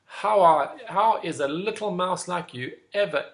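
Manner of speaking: loud, incredulous big lion; scoffing lion. Acted. Big lion mocking the little mouse, loud scoffing. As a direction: scoff loudly at the tiny mouse. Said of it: "The lion cracked up laughing".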